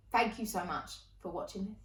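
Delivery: normal talking voice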